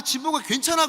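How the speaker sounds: "진보가 괜찮아" high and loud, rising on 괜찮아; imagined warm comfort. Retold or imitated. Pastor voicing the mother's hoped-for comfort, high and bright. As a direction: lift it up like a mother's eager reassurance. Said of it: "만약에".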